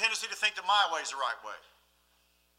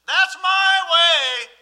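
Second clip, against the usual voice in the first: loud, high and heavily drawn out; emphatic insistence; 8 semitones higher, 12 dB louder, much slower than usual. Acted. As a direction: stretch it out as a stubborn declaration. Mock insistence on own preference.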